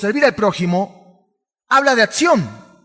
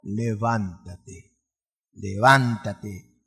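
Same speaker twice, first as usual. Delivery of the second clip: "Levántate, levántate" very slow and lower, stretched out; drawn-out command; 9 semitones lower, 4 dB quieter, much slower than usual. Acted. The Lord telling Saul to rise, slow and low. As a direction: draw each "levántate" out slowly and low.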